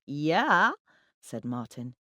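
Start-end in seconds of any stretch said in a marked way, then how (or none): 0.1-0.7 louder, higher eager yeah; talking too loudly. A narrator's reading. Account manager jumping on boss's agreement, talking too loudly. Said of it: "talking too loudly".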